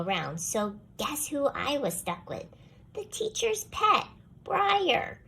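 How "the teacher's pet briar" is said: high and a bit louder, fed up; exasperated. A narrator's reading. Child narrator stuck pairing with the dog, high and exasperated. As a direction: announce the partner with high exasperation.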